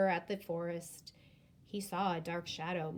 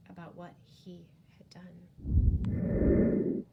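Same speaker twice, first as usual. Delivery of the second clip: slow, breathy sad whisper; breathy sorrowful hush; 8 dB louder, much slower than usual. A narrator's reading. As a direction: whisper it with regret.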